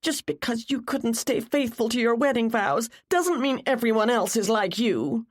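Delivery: high, hurt accusation; upset, high-voiced rebuke; spat in tearful anger. A narrator's reading. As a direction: throw it at her, pitched well up. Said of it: "she spat".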